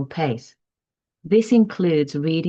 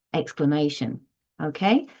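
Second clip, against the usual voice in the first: normal talking voice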